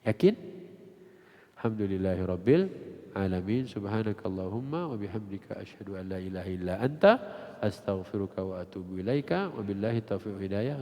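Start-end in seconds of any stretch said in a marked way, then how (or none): 1.6-10.8 closing prayer. Real, not acted. Preacher closing with Arabic prayer, low recitation.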